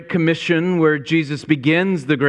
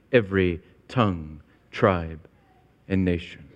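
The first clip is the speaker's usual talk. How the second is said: tongue, tribe, and nation dropped much softer and lower; hushed; 9 semitones lower, 6 dB quieter than usual. Acted. Pastor closing the point to his church, hushed and low. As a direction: let the list sink to a near hush.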